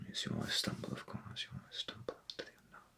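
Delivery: very soft, half-whispered aside; muttered aside. Acted. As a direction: drop right down, as if murmuring to yourself. Muttered aside amid radio monologue.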